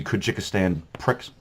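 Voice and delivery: usual speaking voice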